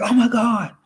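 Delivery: the wife waking up to the fake world, alarmed; shocked realization. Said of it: "So she was like".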